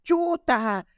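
high-pitched mock mom yell at the soccer game; mom yelling from the sidelines. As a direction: shriek it like an embarrassing mom cheering her son. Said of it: "Me decía".

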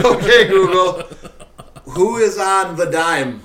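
loud, slow call to the device. Real, not acted. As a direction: call out slowly across the room. Calling to the smart speaker.

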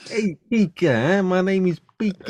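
put-on high, Italian-American accented Pikachu voice; a cartoon critter with an Italian-American accent, pitched high. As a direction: play Pikachu as a New Jersey wise guy with an Italian-American accent. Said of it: "a slightly American Italian accent".

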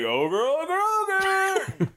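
silly high-pitched catchphrase; goofy catchphrase. Shown as a demonstration. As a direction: squeal the last one up top. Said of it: "I would rather it be like".

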